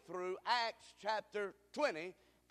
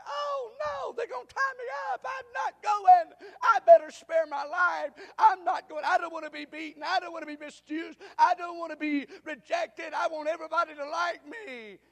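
shouted, far higher, frantic wailing; mock panicked Paul; 8 semitones higher, 11 dB louder than usual. Paul imagined refusing Jerusalem, in hammed-up panic. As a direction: ham up a terrified refusal. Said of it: "Paul didn't say".

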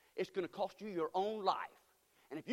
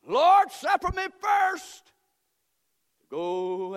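shouted, drawn-out plea; 7 semitones higher, 11 dB louder, much slower than usual. Acted. Preaching the excuse, shouting.

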